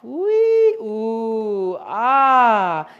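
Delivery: giddy bath-time noises; playful squeals. Acted. Groomer showing the joy of frothing, loud squeals.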